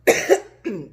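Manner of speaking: short forced cough; acted cough. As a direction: cough out loud as if sick. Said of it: "I started coughing".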